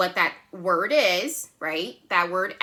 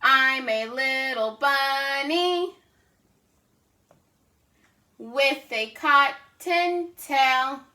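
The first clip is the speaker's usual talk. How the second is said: slow, higher sing-song poem reading; reading a children's poem; 4 semitones higher, much slower than usual. Acted. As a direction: read it slowly and playfully to kids. Teacher reads shared poem.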